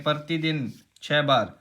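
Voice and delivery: ordinary delivery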